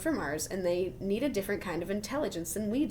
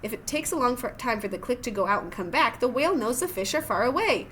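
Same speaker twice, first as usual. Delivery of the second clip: usual speaking voice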